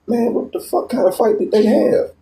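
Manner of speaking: high incredulous question; mock-shocked, voice rising high; amazed. Sees shattered window.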